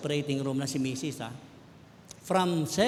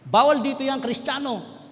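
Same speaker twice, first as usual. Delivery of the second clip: loud, higher mock guard shout; guard's yell; 7 semitones higher, 8 dB louder than usual. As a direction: bark them out like the guard. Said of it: "Pinalabas kami". (sigaw ng gwardiya, mas malakas at mataas)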